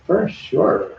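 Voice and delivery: put-on high Valley Girl "For sure". Shown as a demonstration. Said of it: "Now I sound like a Valley Girl".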